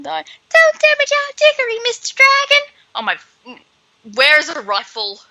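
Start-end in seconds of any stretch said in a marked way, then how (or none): 0.0-2.7 very high falsetto squeal of fangirls; swooning girls. Retold or imitated. Commentator mimics swooning girls begging the dragon, high squeal. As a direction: shriek it like a crowd of swooning girls.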